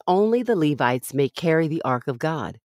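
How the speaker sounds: ordinary talk, nothing marked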